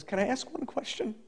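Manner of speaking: lightly voiced meek parrot; timid parrot. Chastened parrot to its owner, softly and timidly asking. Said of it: "the parrot says".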